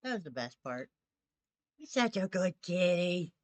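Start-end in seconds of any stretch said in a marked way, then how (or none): 1.8-3.3 put-on loud, gushing baby talk to the kitty; excited cooing at a cat; cooing pet praise. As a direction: gush over the good kitty.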